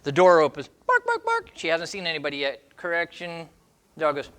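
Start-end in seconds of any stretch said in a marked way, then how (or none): 0.9-1.5 high yappy mock barking; high yapping dog imitation; imitated dog barks. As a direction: yap it like a little terrier.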